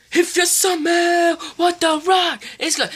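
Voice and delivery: high, slow, shouted wrestler catchphrase; Rock catchphrase. Retold or imitated. Kid imitating The Rock's entrance line, slow loud shout. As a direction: bellow it slowly like The Rock on the mic. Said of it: "let's talk about The Rock".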